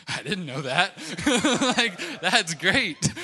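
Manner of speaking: high, loud, laughing reaction; amused storyteller breaking into loud laughter; laughing while retelling. Real, not acted. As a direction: laugh right through the words, drawing them out.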